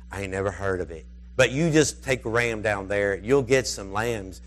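put-on low, folksy old rancher voice; low-voiced sheep farmer. Retold old sheep rancher answers.

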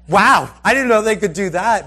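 loud, high amazed reaction; retold wide-eyed amazement. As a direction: act out astonishment with a laugh. Sheep leap over the wall.

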